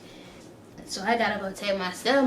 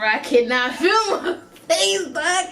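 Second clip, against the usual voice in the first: higher, slower, laughing disbelief; 6 semitones higher, slower than usual. Real, not acted. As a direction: laugh it out slowly. Telling it while laughing.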